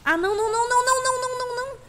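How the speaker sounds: high, frantic string of nos; panicked no. Real, not acted. Streamer panicking at the attacking dinosaur, high frantic nos. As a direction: squeal no over and over as the creature strikes.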